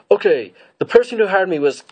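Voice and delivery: high, hammed-up confession into choking; informant cut off. Prisoner about to name the mastermind, choked off in mock distress. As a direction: raise the voice, then choke mid-sentence. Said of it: "she starts getting choked by the Force".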